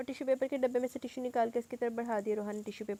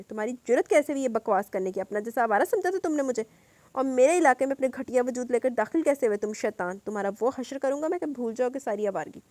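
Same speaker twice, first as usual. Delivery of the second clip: loud, furious scolding while throwing punches; furious shout; 3 semitones higher, 7 dB louder than usual. Acted. Salar yells at the two thugs, enraged. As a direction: roar at them with rage.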